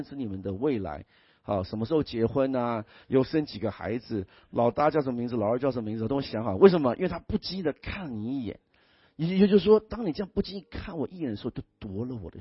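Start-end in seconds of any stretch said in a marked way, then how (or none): none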